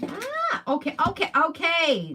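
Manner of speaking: startled high gasp, then quick okays; surprised. Real, not acted. Reader startled as a card falls from the deck, high and surprised. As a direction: react to a card jumping out, high at first.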